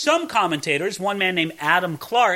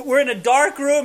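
ordinary talk

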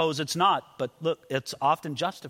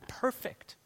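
whisper; 7 semitones higher, 9 dB quieter than usual. Acted. Whispered echo of the word.

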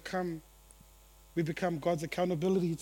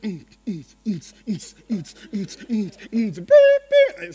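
humming music; 8 dB louder, much slower than usual. Retold or imitated. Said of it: "he heard the music".

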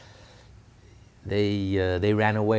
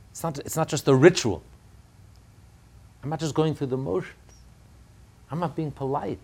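building sermon; 8 semitones higher, 4 dB louder than usual. Acted.

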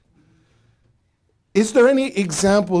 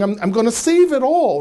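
higher, louder mock boast; greedy rich man; 8 semitones higher, 5 dB louder than usual. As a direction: act out the hoarder's eager resolve. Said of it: "but saying".

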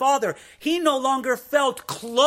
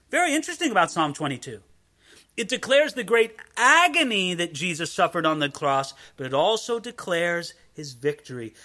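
ordinary talk

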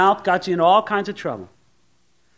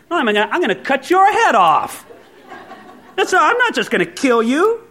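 high, loud, fast and emphatic; bold David threat; 10 semitones higher, 5 dB louder than usual. Acted. David threatening Goliath, loud and emphatic. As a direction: punch out the threat gleefully.

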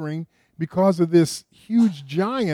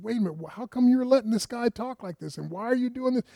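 indignant, higher-pitched retold questioning; upset protest; 8 semitones higher, faster than usual. Young David, upset at Goliath's taunts, challenges the men, indignant. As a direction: act out a worked-up challenge, pitch climbing. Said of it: "He gets upset".

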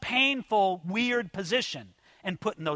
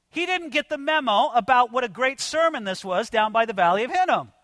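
ordinary talk

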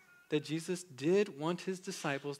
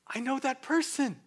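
higher, louder eager cry of recognition; excited recognition; 9 semitones higher, 9 dB louder than usual. Acted. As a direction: disciples calling out as they recognise him. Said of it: "they hear the voice and they say".

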